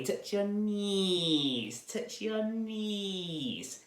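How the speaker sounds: high, long-drawn witch wail; witchy voice. Shown as a demonstration. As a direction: stretch it out like a witch, high and slow. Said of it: "in our witchy voice".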